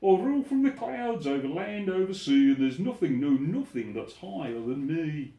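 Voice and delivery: loud, haughty royal bluster; King Yertle bragging from his throne, loud and haughty; boastful turtle king. Acted. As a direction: puff up and lord it over everyone.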